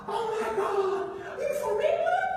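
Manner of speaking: put-on very high, drawn-out squeal of an awed child; loud, high-pitched kid gushing; excited American children. Children thrilled by a British accent, excited squeal. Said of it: "the children are like".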